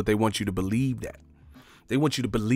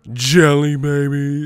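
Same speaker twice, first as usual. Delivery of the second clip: mock embarrassed order; 3 semitones higher, 7 dB louder, slower than usual. Streamer playing a grown man ordering candy at the movies, put-on voice. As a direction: blurt the silly name at the counter. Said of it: "can I get some".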